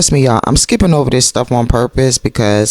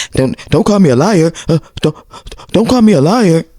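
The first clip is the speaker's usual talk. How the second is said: high, stuttering protest; mimicking Martell; 8 semitones higher, faster than usual. Answering Mel's charge of lying, mimicked protest. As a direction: stammer it out high and indignant. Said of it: "Martell, where's your proof?".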